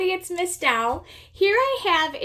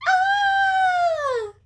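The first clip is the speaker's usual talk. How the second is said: very high but held-back scream; inside-voice scream. Shown as a demonstration. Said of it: "I like to do inside voices".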